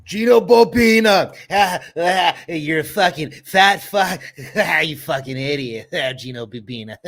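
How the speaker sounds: loud, high mocking outburst with barked laughs; Rob Saul impression. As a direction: yell it high, punctuated with "ah, ah" laughs, then ease off. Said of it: "That's my Rob Saul impression".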